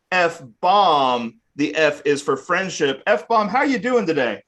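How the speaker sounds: showy game show host build-up; grand announcer voice, slow on the title; announcer intro. Acted.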